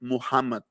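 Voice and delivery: Muhammad with a guttural Arabic h; Arabic pronunciation. Shown as a demonstration. Teacher showing a sound Spanish lacks, Arabic Muhammad. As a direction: say Muhammad the Arabic way, breathing the h from the throat. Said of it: "en árabe se dice Muhammad".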